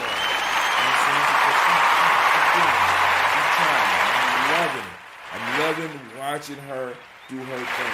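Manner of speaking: loud proud shouting; proud father hyping up his daughter; excited cheering. Real, not acted. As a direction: cheer for Gina over the applause.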